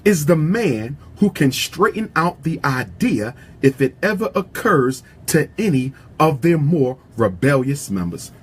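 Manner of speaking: normal talking voice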